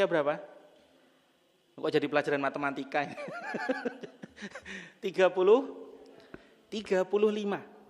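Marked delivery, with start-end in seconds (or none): 3.1-4.8 a burst of high laughter at her own joke. Real, not acted. Preacher laughing at turning the talk into math class. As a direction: laugh out, amused at the math detour.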